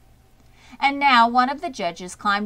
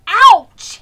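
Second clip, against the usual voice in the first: much higher and louder sudden yelp; screamed; 12 semitones higher, 17 dB louder than usual. A narrator's reading. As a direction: cry out at a sharp bite. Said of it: "he screamed".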